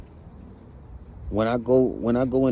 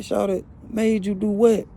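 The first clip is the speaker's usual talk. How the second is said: higher, pressing question; alarmed question; 7 semitones higher than usual. Retold or imitated. Pressing his crying girlfriend for an answer, higher. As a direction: insist on an answer. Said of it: "I'm like".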